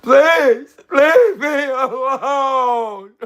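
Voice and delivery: very high, loud, slow wail with a laugh and a sob; hammed-up sobbing beg; mock crying plea. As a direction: redo the plea as a fake crying breakdown. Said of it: "let me do it like this".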